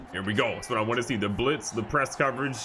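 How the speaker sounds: ordinary delivery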